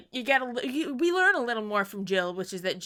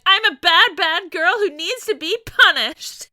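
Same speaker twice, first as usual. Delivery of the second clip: much higher and louder, hammed-up teen melodrama; melodramatic wail; 10 semitones higher, 10 dB louder than usual. Mocking Chloe's self-pity, high loud melodrama. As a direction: wail it like an overblown tragic heroine. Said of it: "Chloe going".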